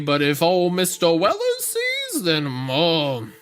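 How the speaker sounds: drawling Creole swamp man warning; lightly voiced bayou storyteller; mock Creole accent. Host warning city boy about the bog in Creole accent. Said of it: "Creole accent".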